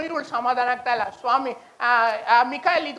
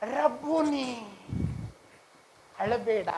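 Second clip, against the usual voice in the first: soft, low murmur of recognition; quiet, soothing acted dialogue; tender; 3 semitones lower, 7 dB quieter, slower than usual. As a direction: speak gently, comforting and low.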